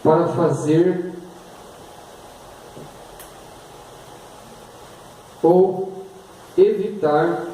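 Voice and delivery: slow, word by word dictation; dictating. Shown as a demonstration. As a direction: dictate slowly with pauses. Dictating definition slowly.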